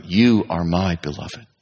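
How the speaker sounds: slow, low and gentle reassurance; God gently reassuring a betrayed listener; tender. Acted. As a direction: say it slowly and tenderly, as comfort.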